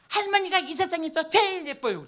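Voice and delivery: put-on loud, high little child voice; granddaughter's voice. The granddaughter tells grandma she is the prettiest, loud child voice. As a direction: belt it out like an excited little girl.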